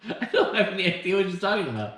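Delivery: laughing through the words; can't stop laughing while talking. Real, not acted. Laughing at confused movie names.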